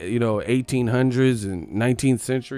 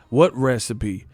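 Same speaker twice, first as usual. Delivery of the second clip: ordinary talk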